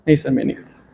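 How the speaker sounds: low, gentle no sir; polite soft refusal. Pretend. Teacher voicing a student refusing him lovingly, softly. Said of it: "मुस्कुरा के मना कर दोगे".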